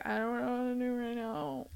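helpless. Retold or imitated. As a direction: barely get it out. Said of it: "I felt so helpless".

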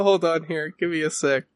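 ordinary delivery

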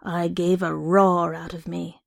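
ordinary delivery

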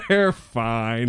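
lightly mocking imitation; imitating her catchphrase. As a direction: echo it back teasingly. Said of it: "she says this one thing".

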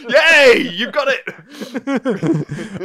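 loud, laughing hooray, pitched high; big teasing congratulations through laughter; mock cheering. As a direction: cheer him on as if for a tiny triumph. Mock cheer for the accent attempt.

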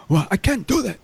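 high, worked-up mock African accent; indignant African accent. Scammer protesting to shop owner, indignant African accent. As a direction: imitate an African caller getting a tone. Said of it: "He's kinda getting a little tone".

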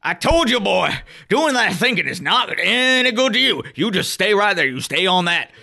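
high, fast hillbilly rant; angry country bumpkin. Acted. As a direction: rant like a furious backwoods father. GM back in father voice.

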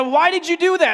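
loud, high-pitched driver's shout; re-enacted shouted accusation; retold angry yell. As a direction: imitate an irate driver yelling. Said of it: "And he's yelling".

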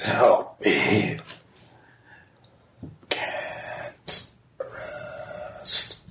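breathy, unvoiced ghost whisper; ghostly whisper. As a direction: whisper it like a lost spirit. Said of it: "The voice whispers".

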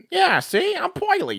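high hammy gangster voice; imitating a cartoon New York mobster; old New York gangster. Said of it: "He talks like an old New York gangster".

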